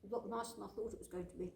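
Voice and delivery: talking through a mouthful of bread; mouth full. Real, not acted. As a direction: mouth full, chewing while speaking. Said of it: "speak with my mouth full".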